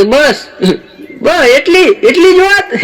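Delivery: mock disbelief that builds up; scoffing surprise. Wife scoffs at husband's worry.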